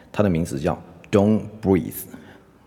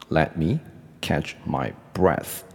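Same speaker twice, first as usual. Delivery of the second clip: "Let me / catch my / breath", with pauses between; slow phrase-by-phrase repeat; slower than usual. Shown as a demonstration. Teacher repeating the example for learners, slow and segmented. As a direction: model it slowly, chunk by chunk.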